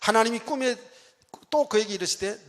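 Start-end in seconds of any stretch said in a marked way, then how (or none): none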